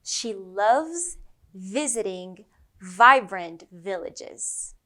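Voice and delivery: "She loves visiting vibrant villages" slow with buzzing V's; slow V sentence. Shown as a demonstration. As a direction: stretch each V out slowly. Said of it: "Let's try another one".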